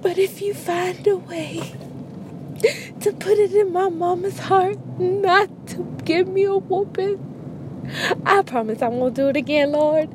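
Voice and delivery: high, pleading kid's prayer, a touch of mock crying. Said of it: "'Cause I'd be like".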